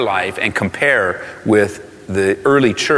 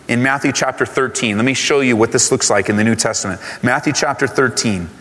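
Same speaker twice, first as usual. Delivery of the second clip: usual speaking voice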